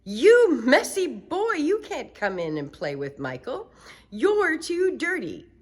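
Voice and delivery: high loud scolding mother. Acted. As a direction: shoo away a messy boy. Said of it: "It said".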